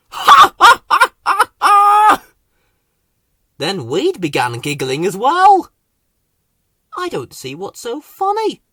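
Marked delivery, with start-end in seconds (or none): 0.1-2.2 very high, loud cackling laugh. A narrator's reading. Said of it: "started laughing". 7.0-8.6 higher, huffy bird voice. A narrator's reading. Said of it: "said Pry huffily".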